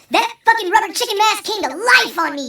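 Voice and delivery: ordinary talk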